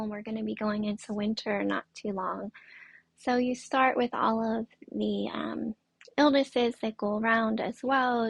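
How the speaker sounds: normal talking voice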